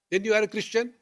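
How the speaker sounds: lightly voiced nosy stranger, probing; questioning stranger.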